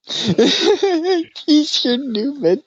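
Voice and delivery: squeaky high laugh through the words, very slow. Real, not acted. Host cracks up at the guest's story, laughing high.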